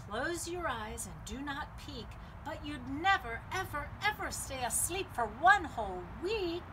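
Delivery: plain reading voice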